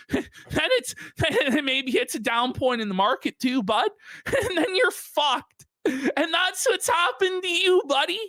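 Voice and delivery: high, gleeful taunt broken by laughter; laughing mockery. Real, not acted. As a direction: crack up while rubbing it in. Gleeful mocking, laughing through words.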